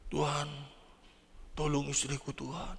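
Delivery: quiet pleading prayer; a soft, hushed plea for the sick wife. Retold or imitated. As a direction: beg God softly for the wife. Husband praying for sick wife.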